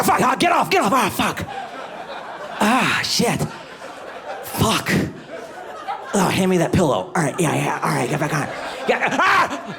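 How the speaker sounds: high strained pained groans; mock back pain moans. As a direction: ham up sex turned back agony. Acting out back pain porn.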